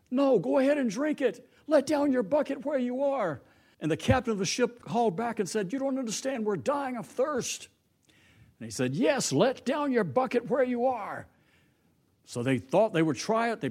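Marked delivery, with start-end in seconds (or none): 0.1-3.4 ship calling out. Acted. Passing ship calls advice across. 5.7-7.7 desperate captain. Acted. Captain calls back to the other ship, pleading. 9.0-11.3 high, slow, insistent call; ship calling out. Acted. Passing ship calls back, high and slow.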